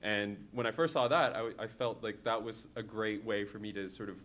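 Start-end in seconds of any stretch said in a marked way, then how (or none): none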